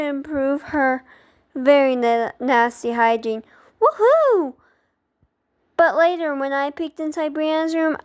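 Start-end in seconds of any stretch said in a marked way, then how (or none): 3.8-4.5 high, swooping woohoo; gleeful little victory whoop; gleeful cheer. Acted. Narrator's mock cheer over hygiene.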